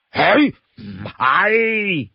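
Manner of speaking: long, drawn-out slow denial. Acted. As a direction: stretch out a teasing no. Li Kui playfully denies guesses.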